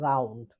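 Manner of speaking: রাউন্ড with a clear initial r; R pronounced. Shown as a demonstration. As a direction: sound the r starting রাউন্ড. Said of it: "আর এর উচ্চারণ হবে". (র উচ্চারণ করে রাউন্ড)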